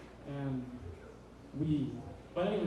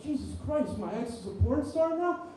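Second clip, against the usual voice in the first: panicked disbelief; 6 semitones higher than usual. Retold or imitated. Discovering ex's porn channel.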